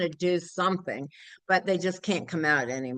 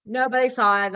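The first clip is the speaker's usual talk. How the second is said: ordinary delivery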